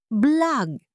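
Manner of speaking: usual speaking voice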